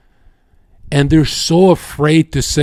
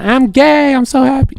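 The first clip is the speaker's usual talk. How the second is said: very high, giddy mock-flamboyant squeal; 12 semitones higher than usual. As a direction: exaggerate gleeful flamboyance, voice way up. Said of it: "flamboyant shit".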